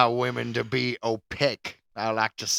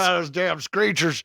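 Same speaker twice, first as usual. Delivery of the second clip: hint of Southern accent; 5 semitones higher than usual. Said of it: "I wasn't trying to do an Irish accent".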